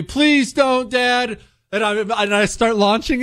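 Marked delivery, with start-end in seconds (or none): 0.0-1.4 high mock yell from the kids; imitated kids yelling. Kids begging dad to stop the ad, mock yell. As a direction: throw up the kids' protest, pitched way up. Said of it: "everyone starts yelling at me".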